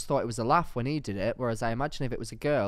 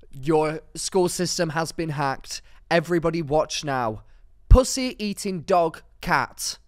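louder, slow mock broadcast announcement; mock hacker announcement; 4 semitones higher, 6 dB louder than usual. Imagining the school assembly hijacked, mock announcement. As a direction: announce the hack slowly, dramatically. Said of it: "it's gonna cut to".

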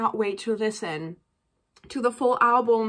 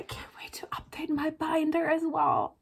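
1 whisper; 3 semitones higher, 7 dB quieter than usual. Real, not acted. Signing off the video softly.

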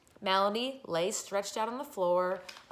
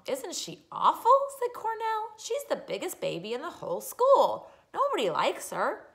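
put-on scornful boy; 10 semitones higher, 4 dB louder than usual. Brother mocking crybaby.

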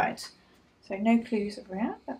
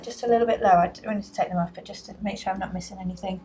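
ordinary talk, nothing marked

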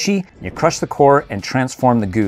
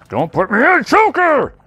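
much higher cartoon protest; Hulk complaining; 3 dB louder than usual. Vlogger voicing Hulk put on the stand, high mock protest. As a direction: whine it as the toy.

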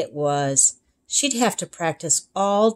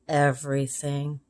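a touch softer and lower, glum; glum child; 4 semitones lower, 5 dB quieter than usual. Acted. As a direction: mope the word out. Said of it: "said Jessica".

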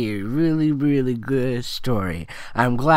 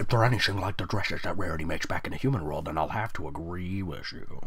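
put-on lower, softer and quick girlish reply; lower Sunset voice; 7 semitones lower, 6 dB quieter than usual. Sunset answering Princess Twilight, lower and quick. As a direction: murmur it low and quick.

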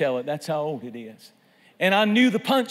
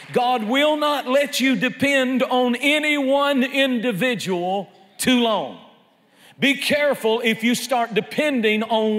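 high, forceful pulpit declaration; full-force preaching; 6 semitones higher, 4 dB louder than usual. Acted. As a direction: drive the point home to the congregation, pitched up.

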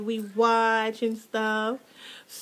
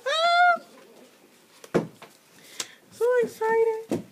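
excited squeal; 12 semitones higher, slower than usual. Real, not acted. Recalling opening box.

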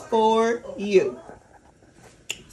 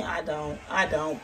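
ordinary talk, nothing marked

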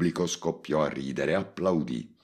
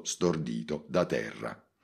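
plain reading voice

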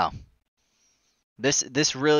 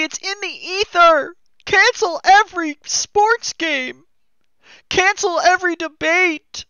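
mocking whiny voice; 5 dB louder than usual. Parodying opponents, high shrill voice.

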